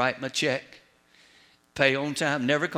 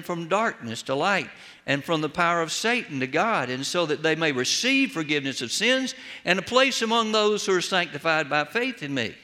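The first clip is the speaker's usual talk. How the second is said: preaching. Acted. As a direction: drive the warning home to the flock. Preaching climax.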